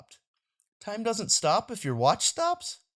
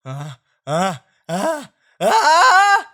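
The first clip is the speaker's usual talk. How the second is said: sneeze build-up; 6 semitones higher, 9 dB louder, much slower than usual. Acted.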